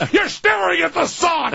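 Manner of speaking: high shouted alarm. Retold or imitated. Tim yelling at his friend staring at the sun, high alarmed shout. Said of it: "and Tim goes".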